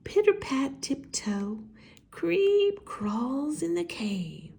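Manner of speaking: high, soft little-mouse voice; tiny mouse voice. A narrator's reading. Narrator introducing the tiny mouse, high and soft. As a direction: make the mouse sound tiny.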